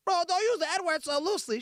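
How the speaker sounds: mocking a commenter. Mocking a viewer who scolded his language, high and whiny. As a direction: parrot it back like a scold. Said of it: "Someone said".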